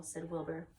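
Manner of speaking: plain reading voice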